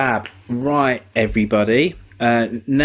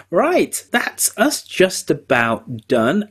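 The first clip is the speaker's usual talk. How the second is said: usual speaking voice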